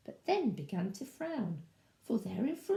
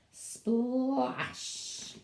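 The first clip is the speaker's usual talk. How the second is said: drawn-out splash. A narrator's reading. Sound word acted out in story.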